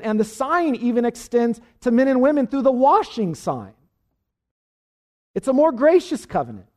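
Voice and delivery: high, loud climactic preaching; preaching at full force. Acted. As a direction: lift the congregation with rising fervour.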